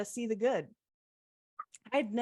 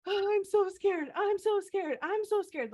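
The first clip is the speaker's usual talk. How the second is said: much higher, imitating a frightened whimper; retold scared sobbing; 10 semitones higher than usual. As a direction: replay the terror on the ledge, half-acted. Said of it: "the epitome of doing it scared".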